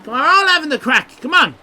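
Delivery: loud fast rallying cheer. Real, not acted. Streamer rousing chat to join the fun, loud and quick. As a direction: rouse the crowd.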